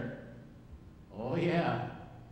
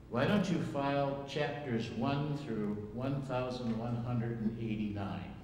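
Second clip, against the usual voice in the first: normal talking voice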